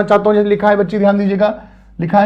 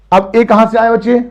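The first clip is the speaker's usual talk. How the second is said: ordinary talk, nothing marked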